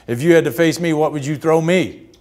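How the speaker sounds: Ted Williams grilling a rookie pitcher, loud and challenging; challenging veteran. Retold or imitated. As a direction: put the rookie on the spot, loudly.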